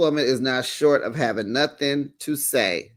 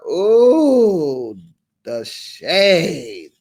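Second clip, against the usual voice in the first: slow, louder drawn-out ooh; savoring the shade; 2 semitones higher, 5 dB louder, much slower than usual. Real, not acted. Host savoring Garcelle's shady remark, slow. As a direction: relish every bit of the shade.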